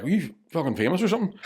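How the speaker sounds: high mocking ask; mock question. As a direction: needle him like a mate. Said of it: "we'd be all like".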